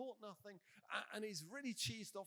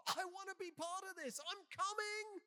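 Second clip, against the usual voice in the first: eager shout; 10 semitones higher, 5 dB louder than usual. Retold or imitated. Simon calling to Jesus on the water, eager shout. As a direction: call out as if leaping in to join.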